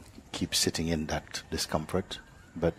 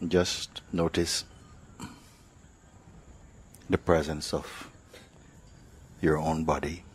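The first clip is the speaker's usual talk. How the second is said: guided meditation; 3 dB quieter than usual. Real, not acted. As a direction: lead them unhurriedly, phrase by phrase, into awareness.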